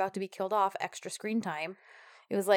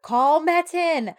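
loud, high, drawn-out mock order; exasperated call-out; 8 semitones higher, 9 dB louder, much slower than usual. Co-host reading out her note on calling the lawyer, mock exasperated shout. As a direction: call it out slowly, pitched way up. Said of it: "and I was like, I wrote".

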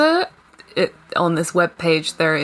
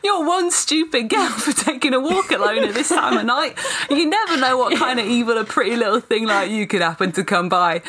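higher, laughing mock British menace; British villain accent; 6 semitones higher than usual. Vampire taunts Sophia in a hammed-up British accent. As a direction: sneer it as a hammy British vampire. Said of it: "I gotta do this".

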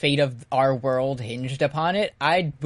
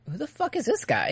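confused outburst; 10 semitones higher than usual. Retold or imitated. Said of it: "they're like".

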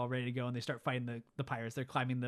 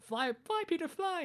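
much higher pleading fairy voice; a tiny desperate helper begging; Tink's plea. Retold or imitated. Tink urging Peter, very high and pleading. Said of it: "he's like".

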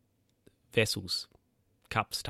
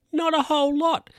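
mock-incredulous; 4 dB louder than usual. Real, not acted. Wry aside on debt size.

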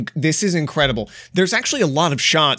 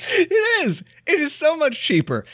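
excited; 12 semitones higher than usual. Acted. Mock enthusiastic agreement.

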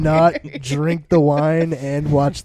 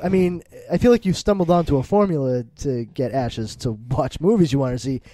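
ordinary talk, nothing marked

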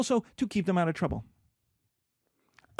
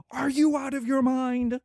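higher-pitched mock disbelief; scolding father; 5 semitones higher than usual. Brother turning on his son, acted scolding. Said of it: "my brother then turns to his son".

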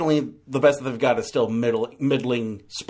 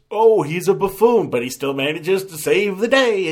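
higher, louder sarcastic mock voice; louder and higher, a sarcastic sing-song; mocking paraphrase; 6 semitones higher, 4 dB louder than usual. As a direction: send up the film's premise with a sneer. Mocking the film's joke.

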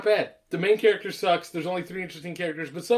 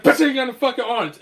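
louder, higher acted outburst; mock indignant retort; 8 semitones higher, 8 dB louder than usual. Metal-armed man answering a complaint, mock indignant. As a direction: fire back in mock outrage.

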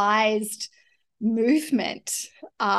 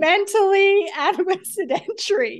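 laughing; 8 semitones higher, 3 dB louder, faster than usual. Real, not acted. Laughing self-mockery.

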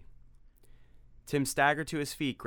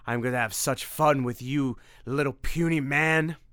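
gloating vampire; 3 semitones higher, 5 dB louder than usual. Acted. Vampire gloating over Tim.

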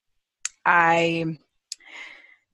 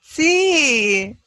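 excited; 7 semitones higher, 6 dB louder than usual. Real, not acted. Excited shared faith.

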